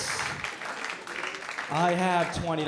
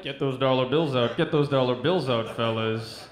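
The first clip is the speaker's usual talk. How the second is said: lower, louder club-announcer call; strip club DJ; 6 semitones lower, 6 dB louder than usual. Pretend. Strip club MC urges fellas to tip, low loud announcer. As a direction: pump up the crowd for dollar bills. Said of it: "Who wants to hear that".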